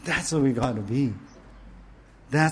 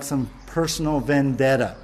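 ordinary delivery

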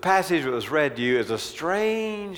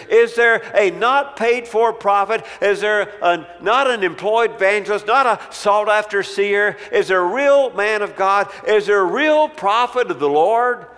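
raised, loud, ringing rhetorical questions; full-force preaching; 6 semitones higher, 5 dB louder than usual. Acted. Preacher pressing the church with questions, at full force. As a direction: preach it hard to the congregation.

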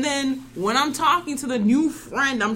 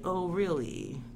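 soft, polite proper voice; 5 semitones lower, 10 dB quieter, slower than usual. Retold or imitated. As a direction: sound prim and well-mannered. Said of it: "it's more of my proper side".

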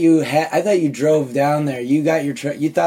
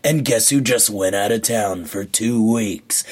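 ad read; 5 semitones lower than usual. Acted. Host opening the show with a mock ad, dramatic voiceover. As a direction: sell the setup like a TV spot.

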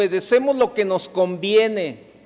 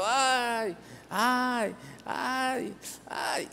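mock cries of pain; 6 semitones higher, 6 dB quieter, much slower than usual. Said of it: "y la señora gritando".

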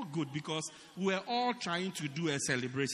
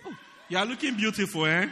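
louder, fast mock praise; sarcastic compliment; 3 semitones higher, 10 dB louder, faster than usual. Mocking a bedraggled girl with fake loud praise. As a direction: gush falsely at her, quick and loud. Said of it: "And you say".

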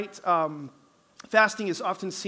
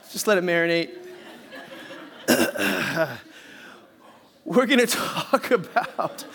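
laughing; 2 semitones lower than usual. Real, not acted. Still laughing after joke.

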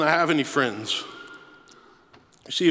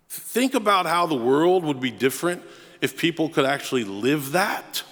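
normal talking voice

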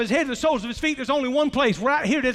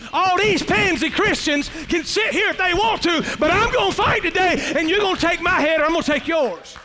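David's defiant vow; 4 semitones higher than usual. Acted. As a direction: throw the challenge at Goliath.

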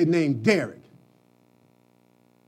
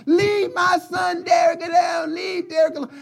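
higher, louder imitated shout down the street; mother yelling; 8 semitones higher, 5 dB louder than usual. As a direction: yell it like an angry mom chasing a bully. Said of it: "she'd be yelling down the block".

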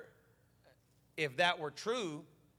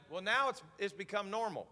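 usual speaking voice

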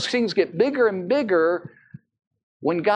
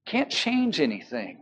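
ordinary delivery

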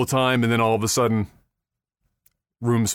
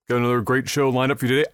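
usual speaking voice

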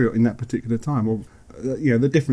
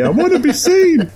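much higher, louder, slow plea to be noticed; attention-seeking child; 6 dB louder than usual. Pretend. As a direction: play the overlooked middle child crying out. Mocking middle child syndrome.

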